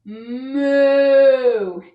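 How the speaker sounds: loud playful moo; cow moo. Pretend. Yoga teacher mooing in cow pose for kids. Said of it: "Two more".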